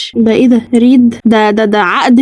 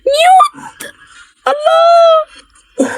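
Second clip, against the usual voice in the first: high startled cry, the last word drawn out long; stretched-out high cry of alarm; shocked; much slower than usual. Real, not acted. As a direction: shriek in shock after the scare. Right after a jump scare.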